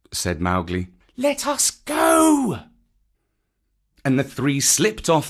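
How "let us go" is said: put-on high, drawn-out boyish call; young boy. Boy pulling his friends away, high slow urging. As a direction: urge them along in a high voice.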